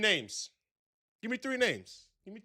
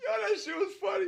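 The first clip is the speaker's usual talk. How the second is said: laughing shout; 14 semitones higher than usual. Real, not acted. Host reacting to funny story.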